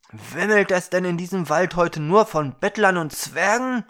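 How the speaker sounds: fed up with beggars and dwarves; scornful outburst. Acted. Young man scorning the hungry girl, loud and exasperated.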